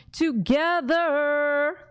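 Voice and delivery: long, slow, rising together; stretched out. Real, not acted. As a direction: draw the word out warmly. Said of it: "drawn-out cheer".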